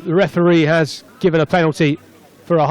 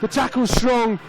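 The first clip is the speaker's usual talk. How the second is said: raised pitch, building as the attack breaks away; excited play-by-play; 5 semitones higher than usual. Real, not acted. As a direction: call the breakaway try live, voice lifted. Live play-by-play of try.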